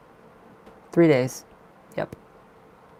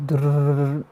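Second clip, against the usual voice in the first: a rolled buzzing drrrr, loud; imitated muscle twitch; 2 semitones higher, 6 dB louder than usual. Telling viewers about a cheek twitch, a loud rolled drrrr. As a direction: mimic your cheek twitching with a trilled rattle. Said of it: "my cheek muscle went like".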